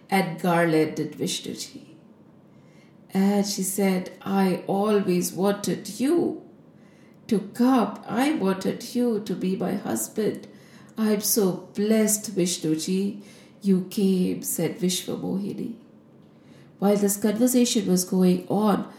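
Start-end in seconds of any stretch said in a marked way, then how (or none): 4.2-14.4 soft, grateful declaration of love; tender devotion. Acted. Vishwamohini garlanding Vishnuji, soft and tender. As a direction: speak softly to your beloved, blessed.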